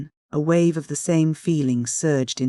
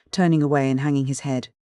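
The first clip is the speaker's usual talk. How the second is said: ordinary delivery, nothing marked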